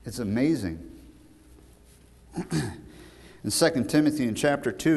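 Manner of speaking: ordinary talk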